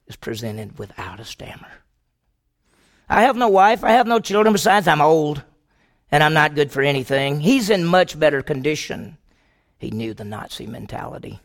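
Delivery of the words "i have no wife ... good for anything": impassioned plea. Acted. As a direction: cry out an old priest's offer to die in his place. Kolbe's plea voiced in sermon.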